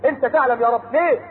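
ordinary talk

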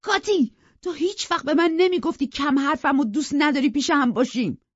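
put-on hurt young man angrily protesting; angry. A narrator's reading. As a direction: cry out hurt and furious. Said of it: "با عصبانیت گفت".

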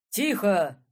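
loud, stern judge's command; thundering stern shout. Acted. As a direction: boom it out to silence the hall. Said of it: "прогремел грозный голос судьи".

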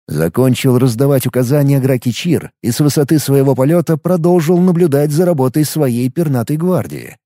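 plain reading voice

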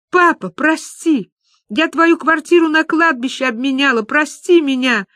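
high, anguished begging; acted sobbing plea. Люба kneels before her father's portrait, pleading tearfully. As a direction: beg forgiveness in a high, tearful wail. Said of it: "И Люба снова зарыдала".

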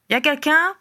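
high, questioning call. A narrator's reading. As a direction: call out to see if anyone is there. Appel lancé par la cheminée. (appel interrogatif lancé d'une voix aiguë)

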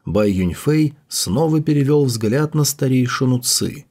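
usual speaking voice

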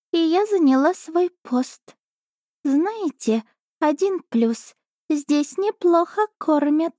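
high, slow purring cat voice; a smug little cat savouring her dinner; chatty cat familiar. Acted. Cat familiar telling princess about dinner, high slow voice.

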